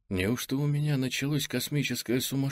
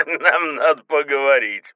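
loud, cheerful laugh from a helmet; 6 semitones higher, 10 dB louder than usual. Acted. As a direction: laugh louder and merrier. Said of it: "всё громче и веселее голос начинает смеяться".

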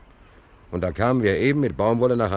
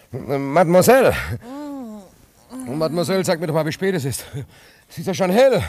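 calls out louder and higher in a panic; alarmed calling; 9 semitones higher, 4 dB louder than usual. Acted. Sailor waking at dawn calls for the woman, alarmed. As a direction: wake up startled and call for her.

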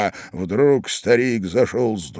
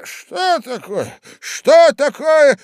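high, drawn-out startled cry; old man's shout; 13 semitones higher, 5 dB louder, much slower than usual. A narrator's reading. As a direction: cry out slowly, louder the second time. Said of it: "закричал старичок". (встревоженный крик старичка)

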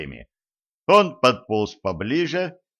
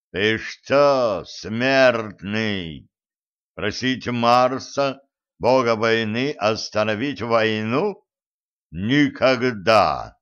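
thundering refusal; much slower than usual. Acted. Mars refuses Merlin.

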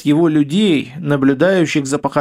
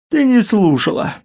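higher-pitched, lightly yawned reply; yawning; 5 semitones higher than usual. A narrator's reading. Sleepy husband answers his wife, yawning. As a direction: say it through a mild yawn. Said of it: "с зевком ответил он".

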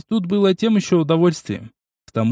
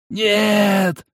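higher, shouted inhuman cry; monster shout; 7 semitones higher than usual. A narrator's reading. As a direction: roar out in pain. Monster cries out in pain.

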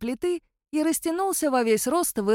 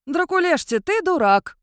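констатируя; 8 semitones higher, 6 dB louder than usual. A narrator's reading. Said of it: "констатировал он".

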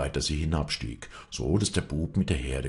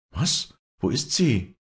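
higher, startled questions; a sudden alarmed what, where is she; 8 semitones higher, 4 dB louder, slower than usual. A narrator's reading. Walli, startled by the boy's news, asks where Luckhardt is. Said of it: "fragte Walli erschrocken".

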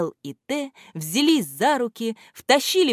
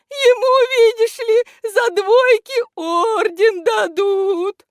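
very high, loud, drawn-out voice; писклявый голос; 7 dB louder, slower than usual. A narrator's reading. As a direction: squeal it out in protest. Тот же высокий голос Листика-Ластика или невидимого. (пискляво, громко и протяжно)

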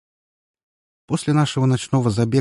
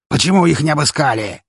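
angry shout; 7 semitones higher, 6 dB louder than usual. Acted. Boss yells at his men.

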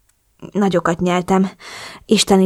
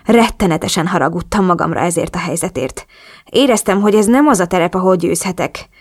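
ordinary delivery

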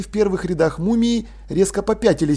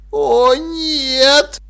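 high, drawn-out moan; 8 semitones higher, 4 dB louder than usual. A narrator's reading. Dil dreads what he sees, moaning. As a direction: groan the words out high. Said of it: "простонал Диль".

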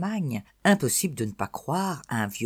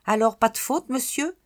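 put-on eager child; 10 semitones higher, 5 dB louder than usual.

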